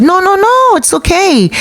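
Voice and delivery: quick-to-placate voice, way up high; retold high, insistent no-no-no; high-pitched reassurance. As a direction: mimic a woman hurrying to reassure. Woman soothing an upset stranger.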